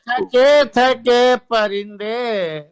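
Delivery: stately nazm recitation. Acted. Reciting sher at mushaira.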